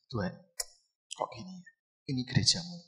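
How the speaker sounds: soft, sorrowful retold prayer; understated hurt, murmured to God; tearful, sad. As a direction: quietly imitate a sad plea to God. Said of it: "Saya di kamar lagi nangis, lagi sedih".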